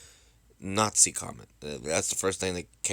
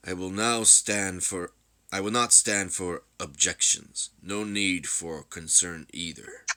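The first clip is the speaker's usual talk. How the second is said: slow, measured royal decree; calm, final authority; solemn ruler; much slower than usual. Acted.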